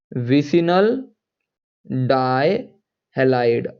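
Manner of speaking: drawn-out "vicinal", pause, then stretched "dihalide"; slow dictation. Real, not acted. Teacher dictating a chemistry term to students, slow dictation. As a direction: say "vicinal dihalide" very slowly, piece by piece. Spells out term slowly while writing.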